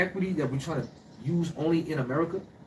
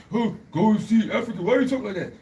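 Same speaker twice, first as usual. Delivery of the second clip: imitated puzzled visitor; 7 semitones higher, 5 dB louder than usual. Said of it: "a person like him comes over there".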